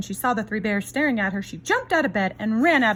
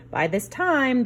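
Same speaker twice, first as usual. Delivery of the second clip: usual speaking voice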